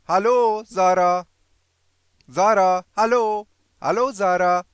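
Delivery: high, loud model greeting; loud repetition drill. Shown as a demonstration. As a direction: call out each word for learners to copy.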